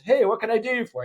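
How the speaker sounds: bright, high friendly greeting; cheery mechanic. Retold or imitated. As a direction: greet him like a happy mechanic.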